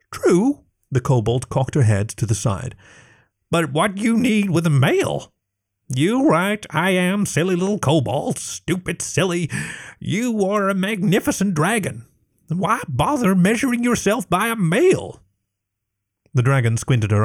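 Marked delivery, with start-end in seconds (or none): 0.1-0.6 high, small kobold voice; a meek little lizard servant; timid kobold. Acted. 3.5-15.2 high, broken-grammar kobold voice; a little lizard cheering up her queen; earnest kobold. Acted. Kobold consoles dragon.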